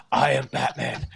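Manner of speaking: a light take on Keaton's Batman line; lightly voiced Keaton Batman, almost conversational; hint of Keaton as Batman; Michael Keaton Batman. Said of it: "are you Michael Keaton?".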